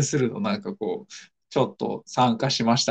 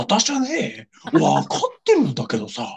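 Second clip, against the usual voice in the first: Oizumi Yo impression; 7 semitones higher than usual. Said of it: "大泉洋だったらできるけど".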